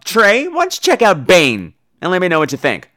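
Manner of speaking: usual speaking voice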